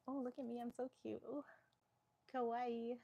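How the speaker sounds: high cutesy mock influencer voice. Mocking influencers on stream in a high cutesy mock voice. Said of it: "influencers going like".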